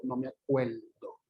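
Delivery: "acuerdo" con l en vez de r, "acueldo"; lateralización. Shown as a demonstration. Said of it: "con la lateral". ("acuerdo" con la r vuelta l)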